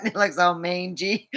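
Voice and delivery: ordinary talk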